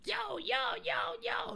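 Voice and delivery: high mock yell of an angry little kid; squeaky childish yell, played for the story; imitating the kid yelling. Friend retells the kid yelling at nobody, high mock yell. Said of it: "so the little kid's going".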